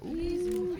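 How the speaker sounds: high, rising "ooh"; startled ooh. Real, not acted. Caster reacting to a close play, high ooh. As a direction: let out a high ooh without extra volume.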